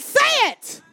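high, loud order; loud command. Shown as a demonstration. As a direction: order the church to say it. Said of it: "I said".

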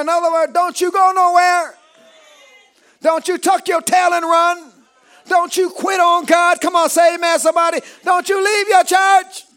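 high, loud exhortation; full-force preaching. Acted. Preacher urging the church to stand still, full-force exhortation. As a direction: preach it out hard to the crowd.

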